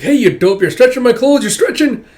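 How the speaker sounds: loud high protest, climbing; indignant yelp. Acted. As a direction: squeal about the clothes being stretched. Tatsuomi complains being dragged.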